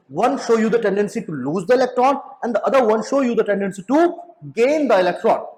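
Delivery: slow, deliberate English definition, word by word. Shown as a demonstration. Teacher defining electrode tendency to students, slow and deliberate. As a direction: spell it out slowly so students can note it.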